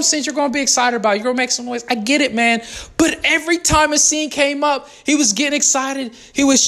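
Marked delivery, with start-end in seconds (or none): none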